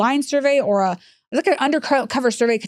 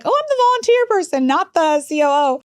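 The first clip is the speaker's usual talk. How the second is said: playing innocent; 6 semitones higher than usual. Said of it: "I'm like".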